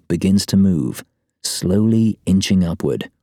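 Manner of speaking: ordinary delivery, nothing marked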